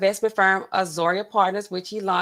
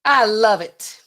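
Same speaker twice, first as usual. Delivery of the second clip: loud, punchy mock quote; Trump; 3 semitones higher, 7 dB louder, slower than usual. Streamer quoting the president's endorsement, loud impression. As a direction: say it big, as a politician's endorsement. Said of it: "How would he say it?".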